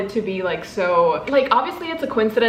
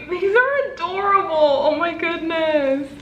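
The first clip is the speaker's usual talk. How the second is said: adoring gush; 7 semitones higher than usual. Real, not acted. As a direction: coo over something adorable. Gushing over crew stickers.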